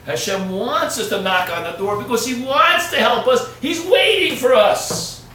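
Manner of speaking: loud high shout, slowing at the end; preaching shout. Acted. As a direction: call it out to the whole room. Preaching climax.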